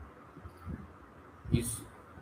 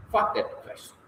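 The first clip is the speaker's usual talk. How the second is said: hushed frustrated whisper; whispered aside; 6 semitones higher, 5 dB quieter than usual. Real, not acted. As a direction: whisper it under your breath. Frustrated aside skipping a question.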